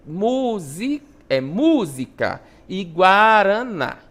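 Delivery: slow "música" and "guaraná", leaning on MÚ and NÁ; stressed syllables. Shown as a demonstration. Teacher showing accented syllables to pupils, stretched stress on "música" and "guaraná". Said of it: "vai ser pronunciada com maior força".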